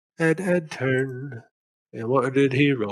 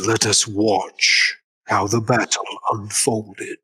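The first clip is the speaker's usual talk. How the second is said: slow, lower arena announcer voice; mystically enhanced announcer; 4 semitones lower, slower than usual. Acted. Game master as announcer opening the battle, slow enhanced voice. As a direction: proclaim it slowly, like a magic-amplified announcer. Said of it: "mystically enhanced voice".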